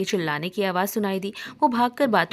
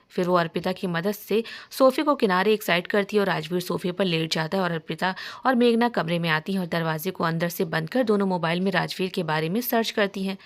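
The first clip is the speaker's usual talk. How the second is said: ordinary delivery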